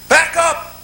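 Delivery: high shouted steering commands; frantic orders. Acted. Chris yelling to the crew about the edge, high and frantic. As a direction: yell it like a panicked captain. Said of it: "going through a bit like".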